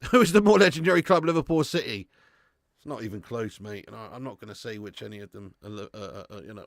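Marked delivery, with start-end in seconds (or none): 0.1-2.1 louder repeat. Shown as a demonstration. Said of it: "repeats the chat question louder".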